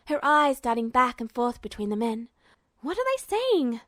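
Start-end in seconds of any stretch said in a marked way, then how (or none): 2.8-3.8 lightly voiced frightened girl. Hild frightened, asking Duo.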